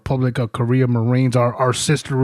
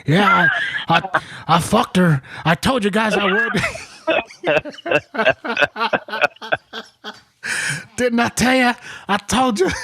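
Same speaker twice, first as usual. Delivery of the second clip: lightly voiced drunk boasting, higher, laughing through it; drunk bragging; 8 semitones higher than usual. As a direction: mimic a giggly drunk sergeant bragging. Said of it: "drunk and he's hiccuping".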